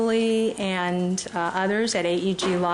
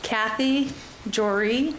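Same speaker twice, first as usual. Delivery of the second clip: ordinary delivery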